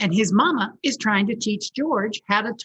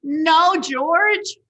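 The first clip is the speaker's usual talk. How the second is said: high, loud no; exasperated mother; 10 semitones higher, 7 dB louder than usual. Acted. Mother stopping George, high and loud. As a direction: cut him off, exasperated. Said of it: "said George's mother".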